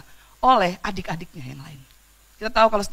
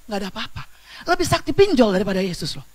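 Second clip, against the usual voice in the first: ordinary talk, nothing marked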